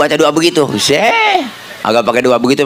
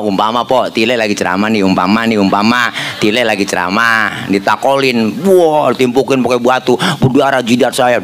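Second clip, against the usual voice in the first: ordinary talk, nothing marked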